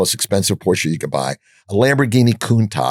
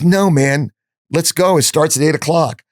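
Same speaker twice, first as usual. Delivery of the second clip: eager retold urging; 7 semitones higher than usual. Nathan urging friends to go.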